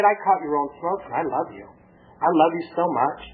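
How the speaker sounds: low, gentle confession of love; loving. Retold or imitated. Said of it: "I talked to her with love".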